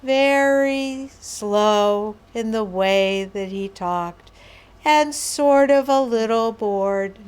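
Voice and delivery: very slow, stretched-out delivery; Mister Rogers. Retold or imitated. Trainer describing a beloved children's host, very slow and a little bored. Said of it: "he had this way of just sort of being very slow".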